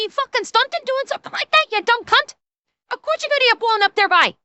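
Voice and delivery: very high, rapid-fire Canadian dialect rant; exaggerated regional Canadian dialect. Scolding a son over putting targets together, fast high dialect. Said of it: "in a more appropriate language".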